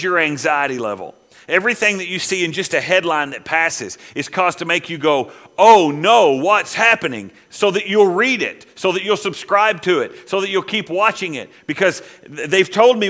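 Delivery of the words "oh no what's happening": a sudden louder, higher "oh no", mock alarmed; mock panic. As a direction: play the startled viewer, half in jest. Imitating an anxious news reader.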